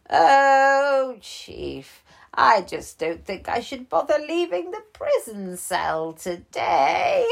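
high, loud and coy bear; a bear who doesn't want to leave; old bear voice. Acted.

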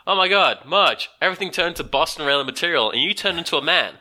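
high, loud panicked gasp; shocked outburst. Retold or imitated. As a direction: freak out, voice up high. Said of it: "it's like".

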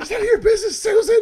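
high, loud mock shriek at mom; bratty teen yell. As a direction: scream it at your mother, cracking. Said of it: "She's like".

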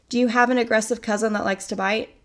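ordinary talk, nothing marked

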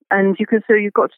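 ordinary talk

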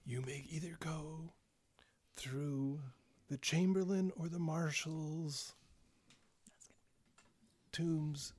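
slow, hushed whisper from the grave; eerie, drawn-out undead whisper; ghastly whisper. Acted. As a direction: answer like a long-dead corpse, whispering. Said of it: "this kind of ghastly whisper from the grave".

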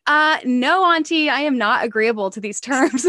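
put-on high, loud child voice digging in; imitating a defiant niece, high and loud; sassy little niece. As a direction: play the stubborn kid refusing the deal. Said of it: "she's like".

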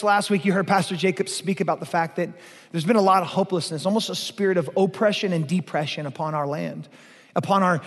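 ordinary delivery

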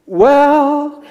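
high, drawn-out hesitant well; nervous stalling. Retold or imitated. Nervous visitor stalling at the intercom, high and hesitant. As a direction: stretch it upward, unsure.

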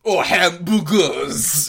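put-on loud, very slow whining French accent; loud slow mock French; mock French waiter. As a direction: drag it out like a snooty waiter. Continuing the waiter impression.

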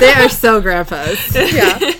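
slow, loud giggly remark; laughing. Real, not acted. Laughing at grandpa characters.

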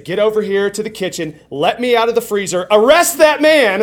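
rising to a loud, high command; urgent demand. Acted. Trapped man orders his boss to rescue him, urgent demand. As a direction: build to an indignant order to the boss.

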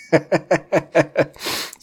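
a burst of high laughter. Real, not acted. Reviewer cracking up at the dirty wizards joke, high laugh. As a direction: let a high laugh break out.

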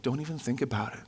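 soft, airy platitudes, lightly sent up; mock inspirational quotes. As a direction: toss out vague encouragement in a hushed mock voice. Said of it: "really weird random inspirational quotes".

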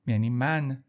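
normal talking voice

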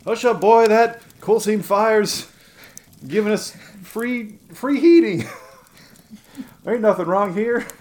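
loud, high mock southern townsfolk; southern accent. Adults dismissing the boy's coal fire warning, southern accent. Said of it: "giving him a southern accent".

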